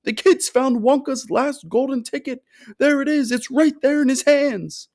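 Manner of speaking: high, loud thrilled shopkeeper; excited scream. A narrator's reading. Shopkeeper screaming to the whole shop about the golden ticket, high and loud. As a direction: scream it like he's about to have a fit. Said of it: "screamed the shopkeeper".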